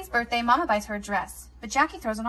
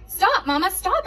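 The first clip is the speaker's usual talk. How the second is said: high loud pleading shout; 8 semitones higher, 6 dB louder, slower than usual. A narrator's reading. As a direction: shout it out to stop her. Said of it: "I shout".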